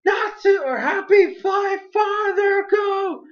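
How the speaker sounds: high, slow, urgent yelled plea; urgent shout. Retold or imitated. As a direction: shout it up after the flying cat. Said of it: "And then Wendy's like".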